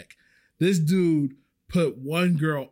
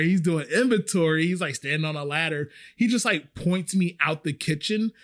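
normal talking voice

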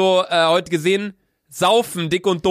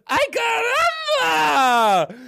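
after a shot of liquor; slower than usual. Real, not acted. Yelling after downing the shot.

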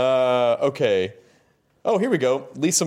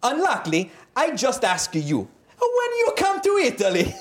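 higher-pitched hammed-up Italian accent; 11 semitones higher than usual. Interviewer reading an Italian fan's letter to David, mock Italian accent. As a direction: ham up a broken-English Italian fan. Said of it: "I'm gonna do another accent".

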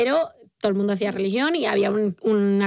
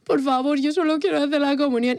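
put-on little girl begging her dad, a touch tearful; pleading crying child; 4 semitones higher than usual. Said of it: "llegué a casa llorando". (niña suplicando a su padre, medio llorosa)

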